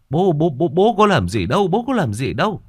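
high, loud stammering protest; frightened father babbling defensively at high pitch; scared, flustered. A narrator's reading. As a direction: plead innocence in a rising panic. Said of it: "Ông ngoại sợ".

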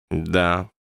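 ordinary delivery

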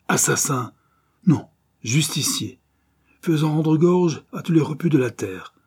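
high, loud righteous declaiming; narrated villain's grand outburst; exalted rant. A narrator's reading. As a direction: proclaim it as a self-styled avenger. Villain rants, justifying himself.

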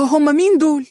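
high, loud, startled question; anxious cry of who; nervous alarm. A narrator's reading. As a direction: blurt it out in alarm. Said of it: "قالت باضطراب وهي متوترة".

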